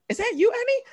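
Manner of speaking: very high, squealing cartoon voice; piercing goofy falsetto; Jar Jar Binks. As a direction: squeal it in mock delighted recognition. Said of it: "Jar Jar Binks".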